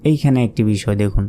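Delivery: ordinary talk, nothing marked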